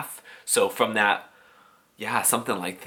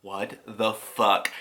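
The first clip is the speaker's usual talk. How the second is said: slow, deflated disbelief; 2 semitones lower, much slower than usual. Retold or imitated. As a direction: drag it out, stunned and let down. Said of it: "And I'm like".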